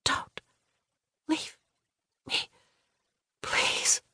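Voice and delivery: weak, halting whisper. A narrator's reading. Injured passenger begging Greg to stay, whispering. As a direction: whisper a plea, word by word. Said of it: "the words came out in a whisper".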